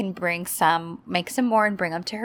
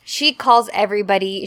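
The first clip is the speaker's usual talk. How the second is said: ordinary talk, nothing marked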